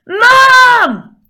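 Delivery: very loud, high, long-held yell; child's shout for mum. Acted. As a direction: yell for Mum like a kid downstairs. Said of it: "altered it slightly to do my kids' voices".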